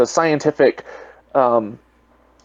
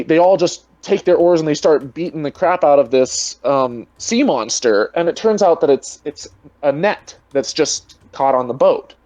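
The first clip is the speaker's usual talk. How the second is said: normal talking voice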